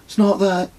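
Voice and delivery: lightly voiced boy, musing; thoughtful. A narrator's reading. Son hesitating at the fence, thoughtful boy voice. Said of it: "said Kez thoughtfully".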